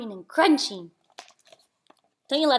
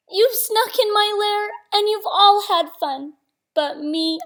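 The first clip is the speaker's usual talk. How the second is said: higher, louder whiny bear; complaining bear; 10 semitones higher, 7 dB louder than usual. Acted. Bear complaining to the party guests, higher and louder. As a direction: whine like a sulky bear.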